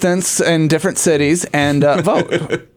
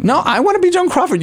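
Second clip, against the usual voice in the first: whiny high demand; 12 semitones higher, faster than usual. Pretend. Playful demand in role argument.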